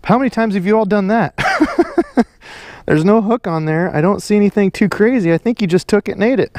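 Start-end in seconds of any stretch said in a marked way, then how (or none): 1.4-2.2 squeaky burst of laughter; a high amazed giggle. Real, not acted. Amazed by the stick bait.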